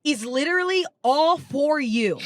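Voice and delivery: imitating an earnest stranger, drawn-out and lifted; stranger's proclamation. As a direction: declare it slowly, stretching every phrase like a grand prophecy. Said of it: "a woman in the crowd comes up to me and goes".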